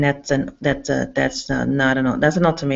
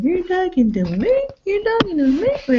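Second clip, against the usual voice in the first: mock tough guy. Retelling him to her listeners, a high mock tough-guy challenge. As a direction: throw the line back like a movie tough guy. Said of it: "He goes".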